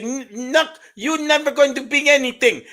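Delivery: high, loud nagging voice; nagging wife. Retold or imitated. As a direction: scold him in a high, loud voice. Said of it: "she said".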